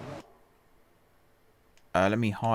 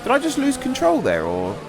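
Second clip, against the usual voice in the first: high, baffled questioning; high-pitched puzzlement at own mistake; incredulous; 13 semitones higher than usual. Real, not acted. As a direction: squeak it in disbelief. Watches replay of crash in disbelief.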